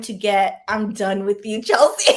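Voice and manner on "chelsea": loud, high burst of laughter; exasperated, amused outburst; laughing. Real, not acted. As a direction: laugh out loud through the name. Jokingly done with herself, laughing.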